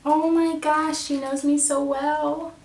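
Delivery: loud, high squeal with stretched words; delighted gushing. Real, not acted. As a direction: gush over a perfect gift. Delighted by a gift just unwrapped.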